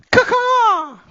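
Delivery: loud high squawking raven caw. Pretend. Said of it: "Best Raven I got there".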